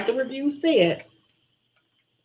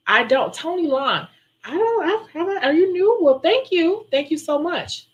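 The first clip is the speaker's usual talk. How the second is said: high, delighted squeal of thanks; surprised and touched; 9 semitones higher than usual. Real, not acted. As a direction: caught off guard by a kind comment. Reading a touching viewer comment.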